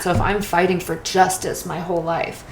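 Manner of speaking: ordinary talk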